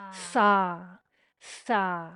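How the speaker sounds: Sa said as a breathy exhaled syllable, like the start of salt; sa sound on exhale. Shown as a demonstration. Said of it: "you make a sa sound".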